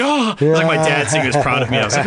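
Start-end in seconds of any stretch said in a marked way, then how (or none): 0.0-0.3 surprised oh. Retold or imitated.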